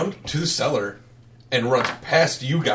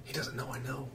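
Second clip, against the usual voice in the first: hushed, near-whispered plea; soft secretive boy; furtive runaway boy; 21 dB quieter, faster than usual. Acted. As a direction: keep it very quiet, barely voiced.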